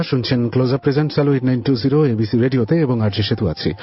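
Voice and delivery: ordinary delivery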